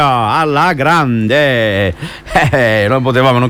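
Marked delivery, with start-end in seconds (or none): 0.0-1.9 "Alla grande" stretched out very slowly; drawn-out catchphrase. Real, not acted. Host drags catchphrase out. ("Alla grande" allungato lentissimo)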